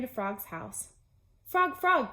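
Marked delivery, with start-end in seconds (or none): 1.4-2.1 high, loud eager call; cried out. Acted. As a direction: call out to Frog. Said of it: "cried Toad".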